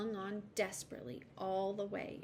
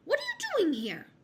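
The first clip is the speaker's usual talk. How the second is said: high, loud magpie screech; unfriendly bird's piercing challenge; shriek; 8 dB louder than usual. A narrator's reading. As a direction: shriek at an intruder. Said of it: "she shrieked at him".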